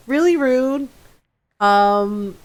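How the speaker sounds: ordinary talk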